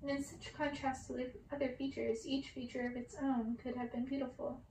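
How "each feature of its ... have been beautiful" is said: usual speaking voice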